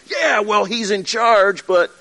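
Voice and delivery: mock sulky pushback; grudging yeah but. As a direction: argue back half-heartedly. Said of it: "you start arguing with the Spirit".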